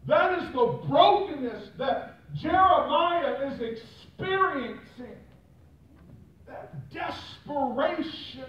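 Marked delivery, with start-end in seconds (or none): 0.1-5.2 building, rising preacher's cry; preaching. Acted. Pastor stirring the congregation about brokenness, impassioned preaching. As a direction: lift the congregation with rising fervour.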